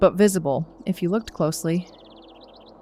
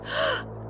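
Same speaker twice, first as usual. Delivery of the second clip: shocked gasp; 8 dB quieter than usual. Acted. Finds Theo tied up.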